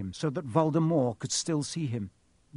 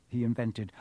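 usual speaking voice

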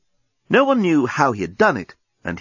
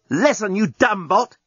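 angry shout; 8 semitones higher than usual. A narrator's reading. Journalist berating the robot.

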